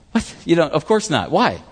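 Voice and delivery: ordinary delivery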